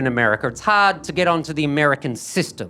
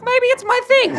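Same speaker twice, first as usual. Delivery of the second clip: much higher, coy and tempted; sudden squeaky change of heart. Acted. Offered cash for feet photos, comedian squeaks a coy yes. Tempted by five hundred bucks.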